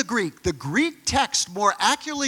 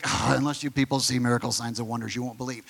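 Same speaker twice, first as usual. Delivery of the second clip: fast, low, fed-up sigh of a scolding; exasperated rebuke; 9 semitones lower, 4 dB quieter, faster than usual. Retold or imitated. Jesus as taught, scolding the doubters in an exasperated tone. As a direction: rattle it off like an annoyed teacher scolding doubters. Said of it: "he's saying it in this way".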